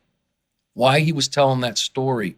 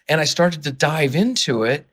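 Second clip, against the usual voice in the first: normal talking voice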